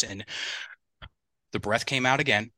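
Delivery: ordinary talk, nothing marked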